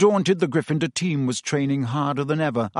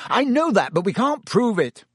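higher, bitter frustrated captain; 9 semitones higher, 3 dB louder than usual. A narrator's reading. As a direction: spit out the frustration of being cheated. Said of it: "said Wood bitterly".

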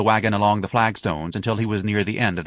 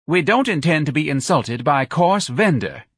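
higher, louder lofty lady's tone; haughty; 6 semitones higher, 3 dB louder than usual. A narrator's reading. The spokeswoman rebukes Ignatius, haughty. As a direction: look down on him. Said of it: "said haughtily".

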